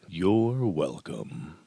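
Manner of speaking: low, drawn-out mock-gracious reply; mock smug. Joke after mock excitement remark.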